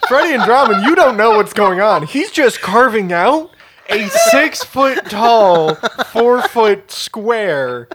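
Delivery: loud, laughing disbelief at the players; exasperated shout. Real, not acted. GM exclaims at players.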